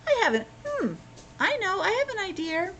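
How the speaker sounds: high eager bear voice; Paddington. Retold or imitated. Paddington tells the foreman his idea, high and eager. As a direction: pipe it up as a little bear with an idea. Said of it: "And Paddington".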